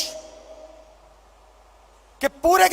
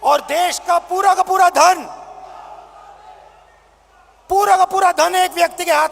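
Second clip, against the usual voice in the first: booming political rally speech. Real, not acted. Rally crowd address, shouting.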